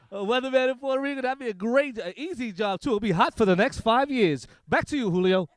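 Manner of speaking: lightly voiced weatherman signing off; mock TV weatherman. Comic weatherman on air, a light anchor voice. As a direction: play a breezy Puerto Rico weatherman.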